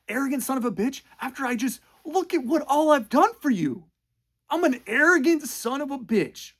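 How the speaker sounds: high, loud, offended ranting; hammed-up wounded pride; indignant mock rant. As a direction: Noah ranting after she insults him. Noah's insulted rant retold.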